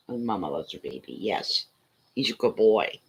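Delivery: low, soft cooing to a dog; tender pet talk. Real, not acted. Mama praising her dog as a good boy, tender pet talk. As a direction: murmur lovingly, low and very soft.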